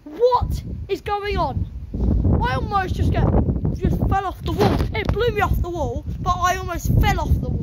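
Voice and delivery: high, loud, scared exclaiming; rattled after nearly falling; shaken and scared. Real, not acted. Blown off the wall.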